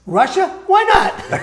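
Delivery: sending up reckless leaders deciding to invade; mock dictator. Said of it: "the way I think the Third Reich did when they said".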